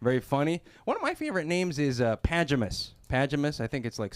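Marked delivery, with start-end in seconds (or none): 2.2-3.6 Pajamas said PAJ-uh-mus; odd pronunciation. Shown as a demonstration. Comic sharing a favorite name, Pajamas pronounced PAJ-uh-mus. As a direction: stress the first syllable of Pajamas, short flat A as in "cat". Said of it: "but pronounced Pajamas".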